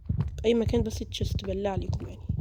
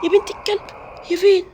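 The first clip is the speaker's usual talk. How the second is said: startled curse; 10 semitones higher, 5 dB louder than usual. Real, not acted. Startled by monster in game.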